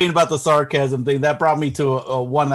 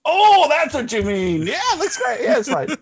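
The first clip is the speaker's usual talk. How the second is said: mock realization; 14 semitones higher than usual. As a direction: act as if it just dawned on you, pitch way up. Feigned sudden understanding on call.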